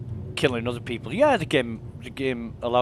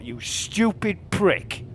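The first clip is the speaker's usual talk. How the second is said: muttered insult; 6 semitones higher, much slower than usual. Retold or imitated. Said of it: "I thought".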